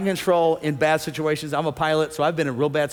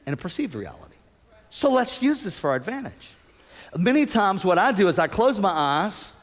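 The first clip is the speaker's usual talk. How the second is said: usual speaking voice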